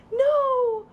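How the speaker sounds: high, loud, drawn-out mock alarm; alarmed warning. Shown as a demonstration. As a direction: call out to stop a crawling baby. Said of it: "Alarm, as in the baby's".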